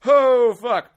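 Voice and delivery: high loud panicked oh fuck; alarmed curse. Retold or imitated. Comic catching the falling lady, loud panic. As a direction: blurt it as she falls. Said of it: "And I'm like".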